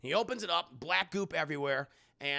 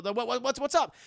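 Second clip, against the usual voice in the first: panicked questions, higher and louder; alarmed; 7 semitones higher, 6 dB louder than usual. Retold or imitated. Scientists on the radio asking, in alarm. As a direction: blurt it out in sudden alarm.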